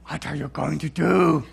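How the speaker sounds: slow, loud exasperated wife voice. Retold or imitated. Wife fed up, asking husband about the missing daughter. Wife's exasperated question retold.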